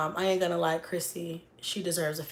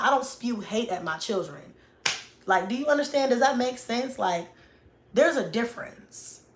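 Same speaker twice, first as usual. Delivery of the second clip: ordinary delivery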